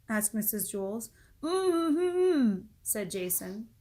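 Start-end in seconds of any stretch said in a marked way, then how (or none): 1.4-2.7 high, loud closed-mouth mm-hmm; taped-mouth thanks; muffled hum through tape. Acted. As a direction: hum with lips sealed.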